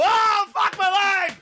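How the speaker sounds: high, slow mock wounded soldier cry; mock pained yell. Acting out his game character getting shot in the leg, hammed-up high cry. Imagines hearing own voiced character.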